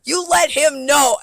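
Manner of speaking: loud high angry yell, slowing on the last words; yelling at the bar manager in the kitchen. Retold or imitated. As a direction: shout it at him, furious. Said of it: "I'm yelling. I'm mad".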